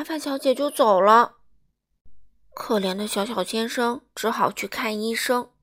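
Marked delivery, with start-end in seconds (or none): none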